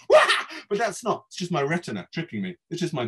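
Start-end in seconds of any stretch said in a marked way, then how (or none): 0.1-0.2 sudden acted yelp; startled yelp. Narrator frightened by the man's painted unface, yelping. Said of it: "and I yelp".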